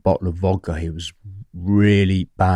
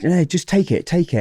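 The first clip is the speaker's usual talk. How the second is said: high-pitched imitated insistence; retold grateful insistence; 9 semitones higher than usual. Grateful barman pushing cash on his friend, high and insistent. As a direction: press it on him, voice pitched up. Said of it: "he's full of gratitude for me".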